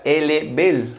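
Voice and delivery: elle est belle as el e bel; elle est belle, linked; French phrase. Shown as a demonstration. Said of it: "ella es bonita".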